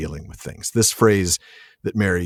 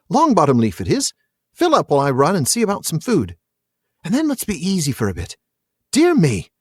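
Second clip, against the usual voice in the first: high, sing-song character reading; lively hobbit voice; 9 semitones higher than usual. A narrator's reading. Reader voices Pippin proposing a smoke, high hobbit voice. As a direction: chatter on in a high, eager hobbit voice.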